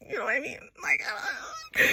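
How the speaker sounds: a hint of a playful fake cry; mock whimper. Vlogger jokingly pining for a foreign girlfriend, mock whimper. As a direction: whine it half-jokingly, voice breaking.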